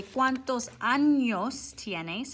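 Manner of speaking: ¿Cuántos años tienes? said slowly in Spanish, clear ñ in años; slow Spanish question. Shown as a demonstration. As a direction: model the Spanish for the class, slowly. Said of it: "we say".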